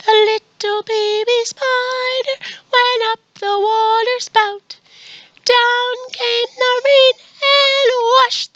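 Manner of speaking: put-on very high baby spider singing; slow, high-pitched little singing voice; teeny tiny voice. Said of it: "Can you make the teeny tiny voice?".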